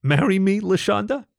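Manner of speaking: high, rising, hopeful question; tentative proposal. A narrator's reading. Topher proposing to LaShonda with the ring, high hopeful plea.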